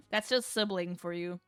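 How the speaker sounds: ordinary delivery, nothing marked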